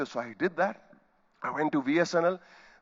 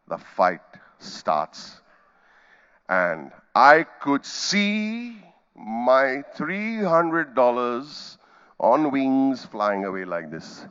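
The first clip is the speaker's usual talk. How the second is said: slow suspense; 3 semitones lower, much slower than usual. Real, not acted. Telling the audience the fight began, very slowly. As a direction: drag each word out very slowly.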